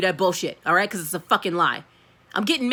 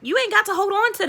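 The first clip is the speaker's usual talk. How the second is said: high, excited call-out of the excuses; exasperated scolding; 12 semitones higher, 3 dB louder than usual. Real, not acted. Scolding viewer, pitch jumps high.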